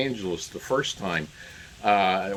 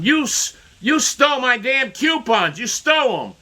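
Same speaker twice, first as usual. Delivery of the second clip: much higher, louder furious accusation; angry neighbor; 11 semitones higher, 4 dB louder than usual. Retold or imitated. Neighbor accusing him of stealing coupons, furious. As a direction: shout it like the raging neighbor. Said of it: "cursing and angry".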